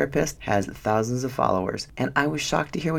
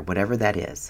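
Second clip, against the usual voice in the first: ordinary talk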